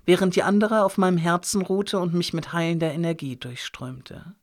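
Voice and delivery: usual speaking voice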